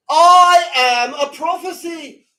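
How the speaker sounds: slow, high, ringing proclamation; loud, drawn-out declaration; fervent shout. Real, not acted. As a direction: cry it out with total conviction. Climax of impassioned sermon.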